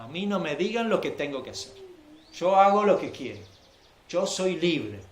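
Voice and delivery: loud, cocky imitation of a rebel; defiant mock boast. Preacher mimicking a rebellious crowd, loud and defiant. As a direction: throw it out like a teenager who answers to no one. Voicing the defiant anarchist attitude.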